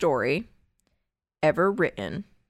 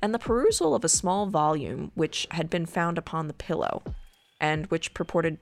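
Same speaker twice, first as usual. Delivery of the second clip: hushed creepy legend telling; 2 semitones lower, 3 dB quieter than usual. Acted. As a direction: read it like a ghost story by candlelight. Reads Poe tale over music.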